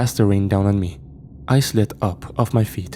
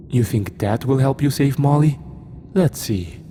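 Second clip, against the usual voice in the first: taunting demon voice; 5 semitones higher than usual. Acted.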